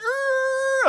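loud, high held screech; imitated car noise, high wail. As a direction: imitate the cars braking behind you. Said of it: "people behind me are going".

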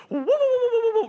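high squealing Stooges woo-woo; Three Stooges woo. Retold or imitated. Angry at the trash can, squealing Three Stooges woo. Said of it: "the Three Stooges".

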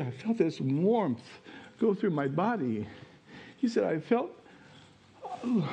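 moved retelling. Retold or imitated. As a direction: speak it as if the burden just lifted. Man describes relief, emotional.

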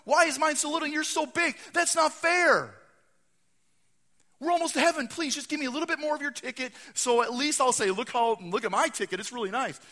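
high, loud aggrieved whine, easing slightly into begging; pleading protest. Acted. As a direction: cry unfair, then plead urgently.

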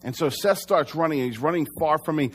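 ordinary talk

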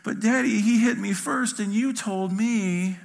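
high mock-crying little-kid voice, slowing at the end; tearful child. Small son justifying himself to daddy, tearful. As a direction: plead through pretend tears, drawing out the last words. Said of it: "through his tears, he says".

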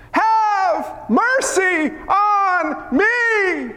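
very slow, high plea, each word stretched; crying out. Acted. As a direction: wail it out high, word by long word. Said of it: "Bartimaeus cried out".

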